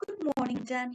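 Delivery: light high little man; cheery high leprechaun. A narrator's reading. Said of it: "he said".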